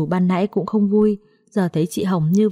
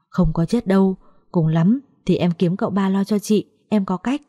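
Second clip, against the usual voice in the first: ordinary delivery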